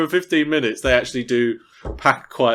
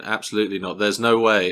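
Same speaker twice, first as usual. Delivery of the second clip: ordinary talk, nothing marked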